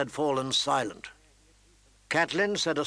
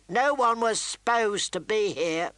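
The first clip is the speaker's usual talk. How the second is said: sour mutter; 7 semitones higher than usual. Acted. Intruder grumbling at the mother in the room. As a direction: grumble it, annoyed to be caught. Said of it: "he muttered sourly".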